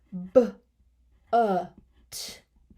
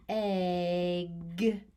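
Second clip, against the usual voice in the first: ordinary talk, nothing marked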